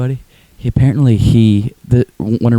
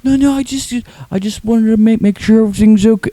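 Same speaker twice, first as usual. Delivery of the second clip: high-pitched, anxious-to-please mimicry; mocking high voice, almost like a deaf speaker; Tim impression; 12 semitones higher than usual. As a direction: imitate him in a high, thick-tongued voice. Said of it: "he talks like this".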